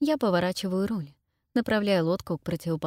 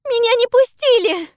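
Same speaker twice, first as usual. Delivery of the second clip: high, louder sobbing scream; 12 dB louder than usual. A narrator's reading. Pippa repeats, louder.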